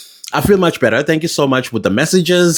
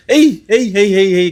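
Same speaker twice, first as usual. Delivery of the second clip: high-pitched exasperated exclamation; 8 semitones higher than usual. Real, not acted. Host lost for words over the official's claim, exasperated. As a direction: throw up a high, disbelieving protest.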